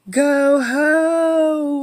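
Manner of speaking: high, loud shriek; shrieking wind. Acted. The wind shrieks its warning at Irene, high and loud. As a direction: shriek it like a gale. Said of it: "it shrieked".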